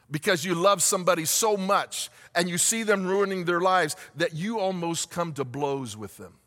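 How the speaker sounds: usual speaking voice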